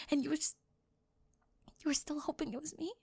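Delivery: hushed, intimate cheerleader confessing to a classmate; soft roleplay confession. Acted. Soft-spoken roleplay confession scene.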